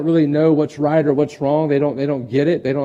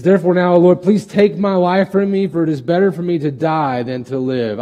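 high, loud, whining plea; whiny Jonah; 4 semitones higher, 5 dB louder than usual. Acted. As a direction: play Jonah as a sulking complainer. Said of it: "He says".

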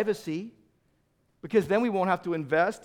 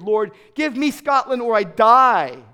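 loud, slow, pleading cry to God; a loud, slow, desperate plea; passionate prayer; 4 semitones higher, 11 dB louder, slower than usual. Retold or imitated. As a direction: beg God with everything you have. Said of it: "He passionately prayed".

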